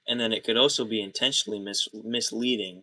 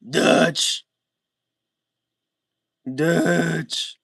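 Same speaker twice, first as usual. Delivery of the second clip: shouted Dutch; 7 semitones higher, 9 dB louder than usual. Retold or imitated. Replaying a game death scene, shouting Dutch loud and high.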